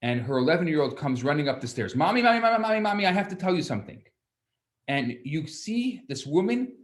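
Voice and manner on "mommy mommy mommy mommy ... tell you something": put-on high, fast excited kid voice calling mommy; eager child. Child running up to mom, high and fast. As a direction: burst in like an eager eleven-year-old.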